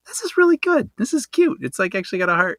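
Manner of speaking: high, lightly retold delight; delighted reaction. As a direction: imitate your own pleased surprise in a high pitch. Retelling own delighted reaction.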